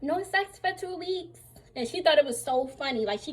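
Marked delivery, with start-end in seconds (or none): 0.0-1.4 mock foreign nurse, higher and slower; imitating the nurse's only English line, slowed and high; imitating broken English. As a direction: parrot her one English phrase, slow and high. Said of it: "The only thing she knew how to say in English was".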